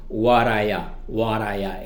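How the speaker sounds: "What are ya?" quick and linked as one word; reduced pronunciation. Shown as a demonstration. Teacher repeating the reduced form for learners. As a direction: slur "What are ya?" together. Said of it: "se pronuncia de manera similar".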